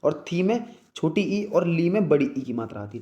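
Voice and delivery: usual speaking voice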